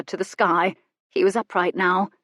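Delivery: usual speaking voice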